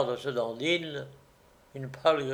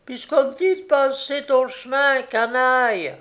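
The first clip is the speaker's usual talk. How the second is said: high, loud yelping woman's voice; shrill sour woman; 9 semitones higher, 5 dB louder than usual. A narrator's reading. Woman inside the house chasing off the gamekeeper, shrill yelping. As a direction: yelp it sourly from indoors. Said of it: "une voix aigre de femme glapit".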